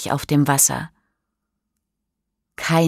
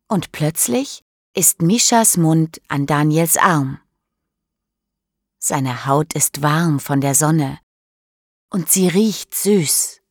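usual speaking voice

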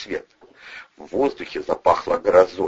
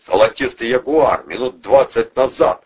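loud, raised-pitch bark; barking shout; 5 semitones higher, 6 dB louder than usual. A narrator's reading. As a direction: bark it out at the officers. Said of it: "рявкнул Протасов во всю глотку". (рявкнуть громко)

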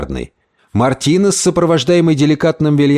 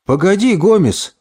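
higher-pitched call to wait; raised, protesting pitch; exclaiming; 8 semitones higher than usual. Acted. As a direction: stop him with an exclamation. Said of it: "воскликнул Мартинес".

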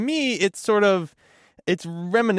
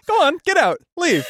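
shooing doctor; 12 semitones higher, 3 dB louder than usual. Retold or imitated. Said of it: "He was just like".

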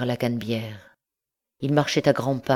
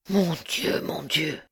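sighed; 4 semitones higher, slower than usual. Acted. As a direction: sigh it out, at the end of his strength. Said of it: "soupira Marius". (un soupir désespéré)

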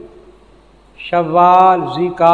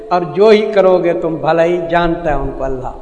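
ordinary talk

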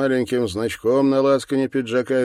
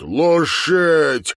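long, loud, high-pitched shout; shouted command; 8 semitones higher, 6 dB louder than usual. Acted. Sergeant kicking the man down, shouting his order. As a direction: holler the one word, stretched out.

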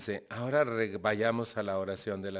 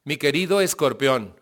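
louder, grand showy announcement; TV astrologer greeting; 3 semitones higher, 8 dB louder than usual. Retold or imitated. Speaker imitating the TV astrologer greeting viewers, showy and loud. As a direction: boom it like a TV horoscope host.

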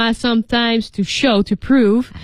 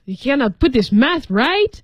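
very annoyed; 6 semitones higher than usual. Acted. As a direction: play someone irritated, stretching the words at a raised pitch. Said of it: "he's very annoyed".

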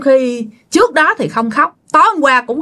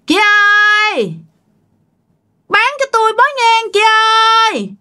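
ghostly call; 13 semitones higher, faster than usual. Retold or imitated. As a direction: call out like a spirit outside the boat. Giọng ma gọi mua nhang. (tiếng ma gọi cao, kéo dài)

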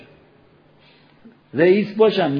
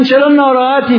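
higher, louder caring call; concerned question; 8 semitones higher, 8 dB louder than usual. Retold or imitated. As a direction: call out to a friend who looks down. Asking the believer why upset.

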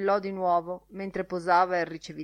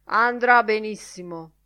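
ordinary delivery

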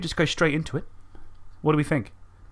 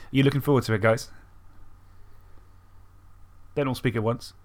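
ordinary talk, nothing marked

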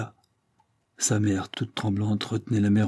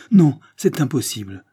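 louder, higher cry of no; desperate refusal; 5 semitones higher, 7 dB louder than usual. Acted. Léopoldine refusing to leave her mother, louder desperate cry. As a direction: throw the refusal out.

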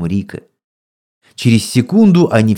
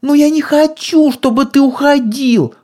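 put-on squeaky little girl. A narrator's reading. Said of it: "пропищал голос".

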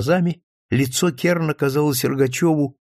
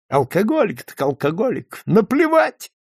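беспечно; 7 semitones higher than usual. A narrator's reading. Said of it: "беспечно тряхнул волосами".

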